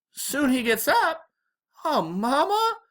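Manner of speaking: high, drawn-out Southern dismay; upset, disappointed, Southern. A narrator's reading. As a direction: echo it back as a dismayed Southern daughter. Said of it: "Mama sounded real upset and disappointed and Southern".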